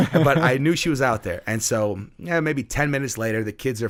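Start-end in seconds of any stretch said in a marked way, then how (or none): none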